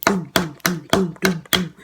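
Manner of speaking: low, punchy doom doom like heavy feet; mock heavy footsteps down a hallway; stomping footsteps. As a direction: thump out each doom like stomping steps. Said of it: "I hear her stomping down the hallway".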